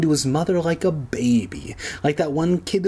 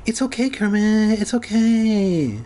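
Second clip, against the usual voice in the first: cooing reassurance; 7 semitones higher than usual. A narrator's reading. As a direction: coo as if to a baby, not really whispered. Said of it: "she whispered".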